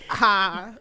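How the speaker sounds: ordinary delivery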